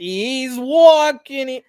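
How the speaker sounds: put-on high, loud, stretched New York accent; a hammed-up New Yorker shouting after someone. Still playing the New Yorker for the co-host, loud New York accent. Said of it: "You, you become a New Yorker?".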